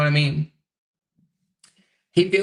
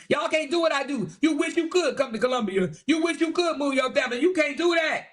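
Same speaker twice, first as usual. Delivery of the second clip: higher, gloating mock voice; mock Kevin bragging to his critics, higher and gloating; mocking boastful Kevin; 9 semitones higher than usual. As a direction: lord it over everyone like a show-off. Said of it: "He said".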